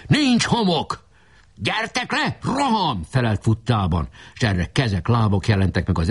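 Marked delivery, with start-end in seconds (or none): 0.1-1.0 high-pitched call down from above; shout. A narrator's reading. Defender calling down from the fort, shouting. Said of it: "lekiáltott az erődről". 1.6-3.0 high-pitched call to charge; shouted order. A narrator's reading. Leader answering the fort while running, shouting.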